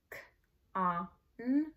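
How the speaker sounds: "k, a, n" one letter sound at a time. Shown as a demonstration. Teacher spelling a new word's first syllable, sounding out. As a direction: isolate each sound in "k, a, n". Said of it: "you wanna spell by syllable".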